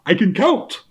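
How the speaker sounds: a hint of the vampire Count; theatrical villain menace, lightly done. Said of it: "Count Dracula Sesame Street".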